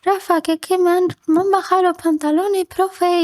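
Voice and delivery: put-on high, tearful child's plea; crying child. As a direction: retell it as a hurt six-year-old complaining to the teacher. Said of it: "Se lo dije llorando".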